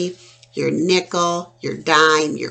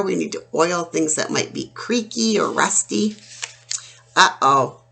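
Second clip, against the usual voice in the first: usual speaking voice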